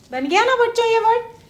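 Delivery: ordinary talk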